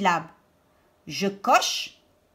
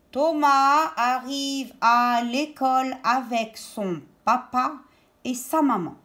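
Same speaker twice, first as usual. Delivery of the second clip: connected reading. Shown as a demonstration. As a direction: link the words, lingering on the vowels. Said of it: "phrase que nous avons appris à lire".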